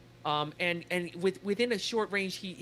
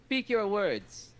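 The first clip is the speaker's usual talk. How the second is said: slow, loud, higher character voice; commanding villain; 5 semitones higher, 5 dB louder, slower than usual. Acted. Game master as villain granting a parley, commanding. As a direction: order it in drawn-out words.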